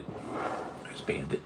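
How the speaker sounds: slow whispered call for the cat. Real, not acted. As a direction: whisper the question slowly so nobody wakes. Looking for the cat at night.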